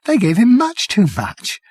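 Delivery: high, tearful whimper; on the edge of crying; close to tears. Acted. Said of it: "He started to cry".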